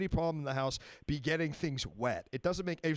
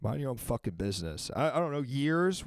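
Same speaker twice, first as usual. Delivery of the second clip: ordinary talk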